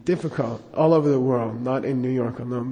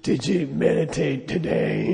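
slow, crushed-voice-box lama; Darth Vader; slower than usual. Retold or imitated. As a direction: imitate a teacher with a damaged voice box, slowly. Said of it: "he talked like Darth Vader".

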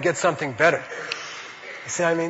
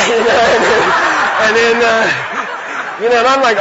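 laughing through words; 3 semitones higher, 4 dB louder than usual. Real, not acted. Laughing after the story punchline.

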